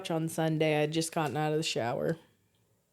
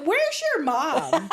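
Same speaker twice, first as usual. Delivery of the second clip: urgent deflection; 9 semitones higher, 5 dB louder, slower than usual. Retold or imitated. Deflecting nephew, retold.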